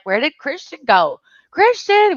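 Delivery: playful fake call, jumping high on the name; mock searching call. Parents pretending not to see their son, mock calling. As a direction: pretend to hunt for a hidden child, calling out. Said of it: "we'd be like".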